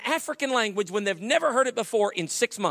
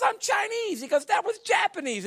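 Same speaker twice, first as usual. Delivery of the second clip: higher, exasperated co-pilot; indignant correction; 11 semitones higher than usual. Retold or imitated. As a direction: set him straight in mock indignation.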